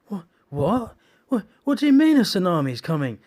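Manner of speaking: high, loud stammering disbelief; can't believe what you just heard; panicked driver questioning the soldier; shocked disbelief. Retold or imitated.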